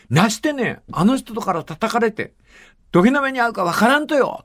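a hint of a daughter's plea in Kyushu dialect, pitch climbing; pleading daughter in Kyushu dialect. Retelling a film, the daughter pleads with her father in Kyushu dialect. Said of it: "宮本信子が言った瞬間".

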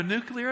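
"nuclear" said the speaker's habitual wrong way; mispronounced word. Real, not acted. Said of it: "I always say that word wrong".

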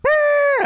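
high loud trilled horn buzz; party horn noise. Pretend. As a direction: blow a noisemaker with your lips. Imitated noisemaker.

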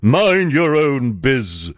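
brusque royal brush-off; curt prince. A narrator's reading. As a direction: snap back slowly as the prince. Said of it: "the prince replied".

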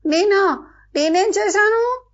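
high, slow disbelief; who, me? rising in shock; taken aback and a little hurt; surprised protest. A narrator's reading. Mona accused, startled protest.

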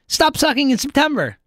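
usual speaking voice

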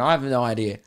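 ordinary talk, nothing marked

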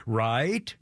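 automated voice. Retold or imitated. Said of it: "Now she goes".

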